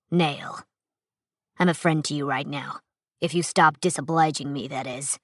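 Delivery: a hint of a hard-bitten veteran; gruff stranger. Narrator voices Nale.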